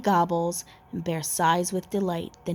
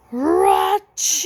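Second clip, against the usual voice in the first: high, loud hammed-up sneeze ra-chew; 11 semitones higher, 7 dB louder than usual. The pepper fleck makes the sleeping bear sneeze loudly. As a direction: burst out with a big comic sneeze.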